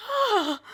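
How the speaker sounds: high theatrical gasp as if fainting; fake faint cry. Girl pretends to faint at work, high mock gasp.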